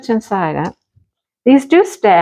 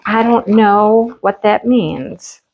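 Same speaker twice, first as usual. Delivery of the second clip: normal talking voice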